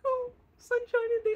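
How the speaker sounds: mock a clueless sunny girl; mock airhead. Mocking naive Mila ignoring danger, high ditzy mock. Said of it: "and you're just like".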